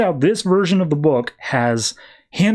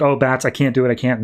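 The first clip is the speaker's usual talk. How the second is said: usual speaking voice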